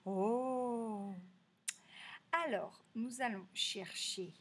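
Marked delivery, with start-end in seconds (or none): 0.1-1.1 drawn-out "oh" of mock surprise; gasp, then hold a long oh; surprised gasp. Teacher acting out Hugo's surprise, surprised oh. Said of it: "il est surpris".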